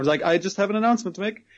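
ordinary talk

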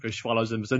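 ordinary delivery

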